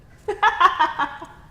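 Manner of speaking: loud, high burst of laughter; bright amused laugh; high giggle. Acted. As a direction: crack up at the absurdity. Amused by the scene.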